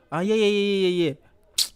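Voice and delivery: high, loud burst of yeahs; delighted to be shown the idea; excited agreement. Real, not acted. Thrilled by a viewer's suggestion.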